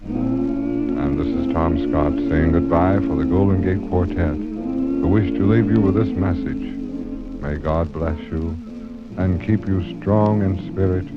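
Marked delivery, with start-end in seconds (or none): none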